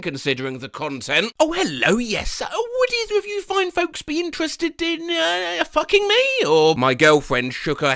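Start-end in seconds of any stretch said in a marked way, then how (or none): 1.4-6.5 high, prim overly polite voice; oddly polite man. Retold or imitated. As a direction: ask sweetly like a creepy gentleman. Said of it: "with an oddly polite way of speaking".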